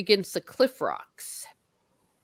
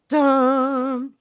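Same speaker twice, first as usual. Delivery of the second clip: dramatic dun; 9 semitones higher, 5 dB louder than usual. Pretend. Dramatic sound effect.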